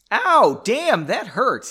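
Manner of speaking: pained exclamation. A narrator's reading. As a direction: yelp it out as the wound stings. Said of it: "he exclaimed".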